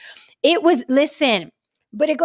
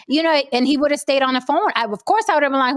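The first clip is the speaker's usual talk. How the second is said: ordinary talk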